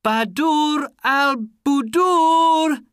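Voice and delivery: loud, high, slow call; long, ringing shout; crying out. A narrator's reading. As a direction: call up to a window for a loved one. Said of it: "he cried".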